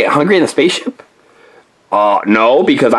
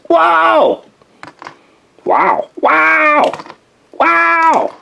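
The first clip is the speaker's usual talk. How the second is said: bouncy wows; 12 semitones higher, much slower than usual. Acted. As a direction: yelp with each bounce. Bouncing high on the moon.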